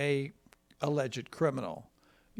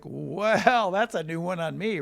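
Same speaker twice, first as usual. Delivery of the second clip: louder, drawn-out "Well" of mild shock; retold astonishment; 4 semitones higher, 7 dB louder, slower than usual. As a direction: retell being taken aback, stretching the first word. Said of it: "I'm like".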